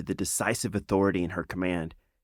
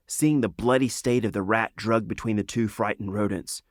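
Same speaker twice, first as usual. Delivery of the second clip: ordinary delivery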